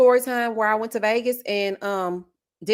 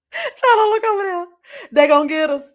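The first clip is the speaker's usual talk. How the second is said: high, loud, hammed-up panicked cry; mock panic; 9 semitones higher, 6 dB louder than usual. To her friend in a car of strangers, hammed-up panic. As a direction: wail it like they're coming for you. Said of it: "I'm like".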